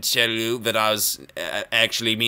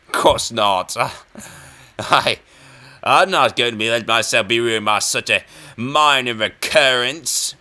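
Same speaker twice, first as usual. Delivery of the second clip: ordinary delivery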